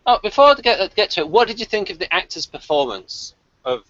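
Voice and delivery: normal talking voice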